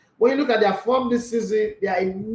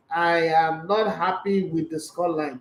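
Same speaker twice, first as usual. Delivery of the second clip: ordinary talk, nothing marked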